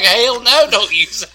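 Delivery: high, loud imitated refusal; mock emphatic refusal. Co-host voicing Cleo's answer to the host, mock indignant.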